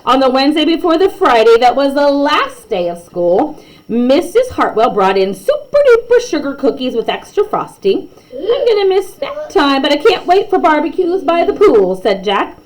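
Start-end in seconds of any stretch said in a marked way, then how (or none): 0.0-8.1 lively read-aloud storytelling; story narration. A narrator's reading. Narrator reading a class story to kids, lively. 8.3-12.0 lightly voiced young student, higher; kid voice. Jack telling the class his summer plans, higher kid voice. Child character quoted in story.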